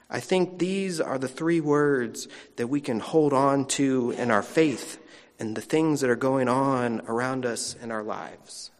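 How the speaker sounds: ordinary delivery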